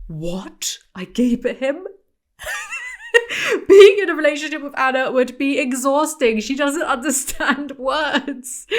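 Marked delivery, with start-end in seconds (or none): 2.4-8.7 cracking up mid-sentence, pitch up; words pushed out through real laughter; laughing. Real, not acted. Host mocking Anna while laughing helplessly.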